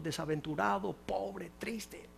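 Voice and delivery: breathy preacher's whisper, dropping away; hushed whisper. Acted. Preacher whispering to the congregation. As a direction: whisper the words like a secret.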